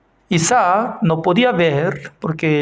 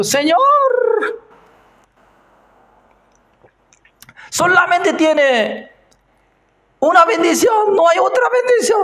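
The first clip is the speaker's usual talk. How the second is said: much higher, pleading, rising to a shout; crying plea; 13 semitones higher than usual. Acted. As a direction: beg through tears for one more blessing. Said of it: "Llorando, llorando".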